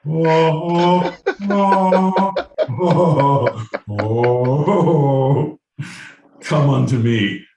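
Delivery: high, loud hammed-up ecstatic moans; mock moaning. Comic mocking a bedroom god with hammed-up moans.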